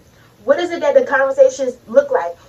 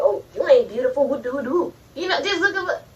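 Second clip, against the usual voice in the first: mocking inner voice; 5 dB quieter than usual. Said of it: "So I'm saying".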